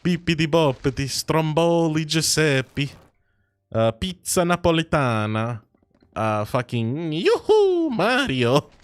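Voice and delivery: high, drawn-out cartoon Italian accent; sing-song caricature Italian; mock Italian accent. Streamer showing how Italians speak, hammed-up Italian accent. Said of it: "How the fuck do Italians speak".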